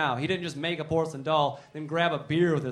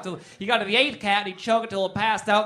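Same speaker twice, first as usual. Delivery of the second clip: ordinary talk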